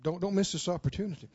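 usual speaking voice

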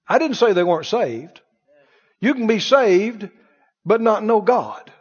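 ordinary talk

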